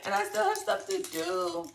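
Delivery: high, fast, crying shout; hysterical panic. Retold or imitated. Refusing to let sister drive, high crying hysterical shout. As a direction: shriek it like you'll be eaten any second. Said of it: "I was like".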